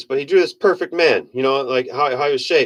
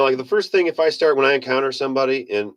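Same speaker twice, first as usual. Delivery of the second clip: ordinary talk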